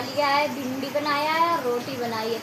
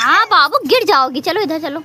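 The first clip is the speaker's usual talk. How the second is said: loud warning call; 3 semitones higher, 10 dB louder than usual. Real, not acted. Mother calling her toddler away from the edge, loud warning.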